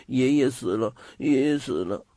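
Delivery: put-on child's voice muttering over and over; tearful boy's muttered refrain, understated; repetitive muttering. A narrator's reading. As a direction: keep mumbling it through held-back tears. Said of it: "嘴里不停地念叨着".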